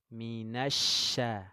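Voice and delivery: slow model of minasya with a spreading sh; drawn-out shin. Shown as a demonstration. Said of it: "Menyebar angin di mulut".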